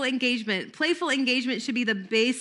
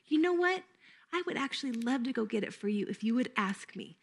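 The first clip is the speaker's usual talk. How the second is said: gentle playful offer; 7 dB quieter than usual. Shown as a demonstration. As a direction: lure her to ask nicely. Teacher gently redirects child.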